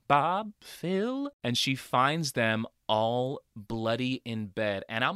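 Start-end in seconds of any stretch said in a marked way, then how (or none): 0.1-1.3 high, questioning call. Retold or imitated. Jess calls for her friends upstairs, imitated high call. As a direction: imitate calling friends' names, pitched up. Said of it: "and she calls out".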